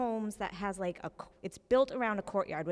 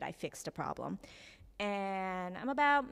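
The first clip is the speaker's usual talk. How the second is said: normal talking voice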